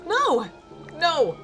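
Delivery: high, loud cry of protest; shouting. Acted. Witch sees a mouse spoil the potion, shouting. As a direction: shout in alarm, drawing it out. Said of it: "I heard a shout from inside".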